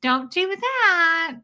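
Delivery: high, slow, drawn-out mock scolding; ineffectual parent. Parent to out-of-control kid in public, mock drawn-out scolding. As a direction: imitate a parent feebly telling a child off. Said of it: "the parent is just like".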